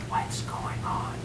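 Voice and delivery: confused hushed whisper. Retold or imitated. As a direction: whisper it, puzzled, as if not to disturb. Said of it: "I don't know why she was whispering".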